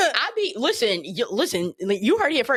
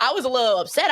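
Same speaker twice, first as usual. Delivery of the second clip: ordinary delivery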